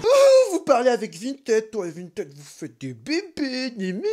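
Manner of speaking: high, slow teasing voice; silly mocking voice. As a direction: tease like a kid.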